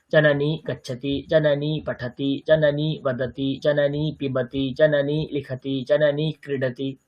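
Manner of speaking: steady, monotone chant-like rhythm; sentence-drill recitation. Shown as a demonstration. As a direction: recite each pair evenly on one pitch.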